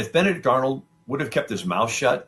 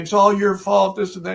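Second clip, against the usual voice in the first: imitating a hysterical wife, much higher and louder; hammed-up accusing scream from a wife feigning madness; wife faking insanity, screaming blame at George Washington; mock screaming wife; 10 semitones higher, 6 dB louder than usual. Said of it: "screaming at George Washington".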